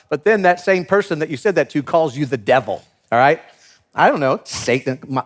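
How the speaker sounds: usual speaking voice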